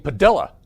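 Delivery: "Padilla" with an English L, as on the news; "Padilla" mispronounced to rhyme with vanilla; English L pronunciation. Retold or imitated. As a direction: say "Padilla" as puh-DILL-uh. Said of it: "they were talking about this guy".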